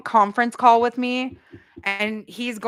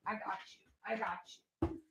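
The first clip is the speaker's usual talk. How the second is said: fading mutter; 19 dB quieter, much faster than usual. Retold or imitated.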